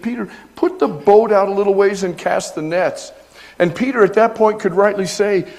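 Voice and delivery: loud, high, rising preacher's voice; preaching at full force. Acted. Pastor preaching the story to his church, full force. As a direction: preach it out to the congregation.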